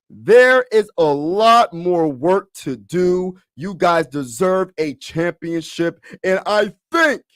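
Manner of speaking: loud, very slow, high player impression; Joel Embiid voice. Star player promising fans a championship, slow and high impression. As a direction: proclaim it slowly in an imitated athlete's voice. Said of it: "put my Joel Embiid voice on".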